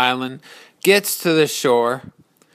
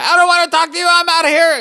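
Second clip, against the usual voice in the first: high, loud frantic yell; panicked shout; 9 dB louder, much faster than usual. Acted. Fleeing man shouts at Courage, terrified. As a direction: shout it while bolting away.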